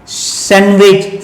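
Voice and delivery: सैंडविच slowly as "san-wich"; slow model सैंडविच without d; silent D. Shown as a demonstration. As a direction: drop the d in सैंडविच, drawn out. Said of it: "ये क्या पढ़ेंगे".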